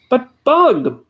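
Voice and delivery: high, loud plaintive moan; a mild pleading wail. A narrator's reading. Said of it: "Horton moaned".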